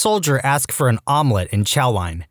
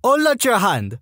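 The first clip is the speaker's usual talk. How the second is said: raised-pitch French-Canadian order; French-Canadian sergeant; 7 semitones higher than usual. Acted. Sergeant ordering recruit, French-Canadian accent. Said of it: "female French-Canadian sergeant with this accent".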